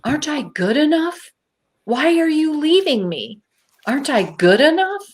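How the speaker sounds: high, wounded questioning; acted-out plaintive appeal; hurt pleading. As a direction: plead to know why you're abandoned. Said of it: "And I said this out loud".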